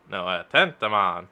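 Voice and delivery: ordinary delivery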